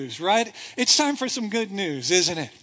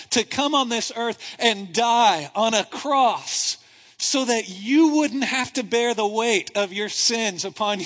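normal talking voice

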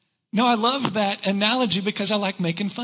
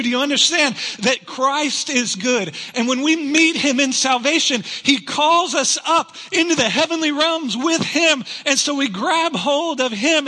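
building preacher fervor, higher and louder; preaching; 5 semitones higher, 3 dB louder than usual. Acted. Preacher rallying the congregation, full rising fervor. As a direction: lift the church with rising conviction.

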